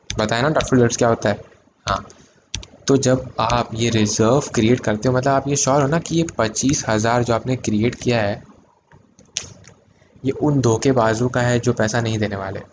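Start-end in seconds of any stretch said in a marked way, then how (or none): none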